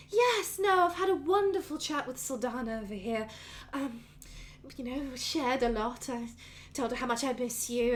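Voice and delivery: high bright start, easing as she chats; doting, chatty mother. Acted. Mother telling son about her chat, fondly chirpy. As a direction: play the fond mother making happy conversation.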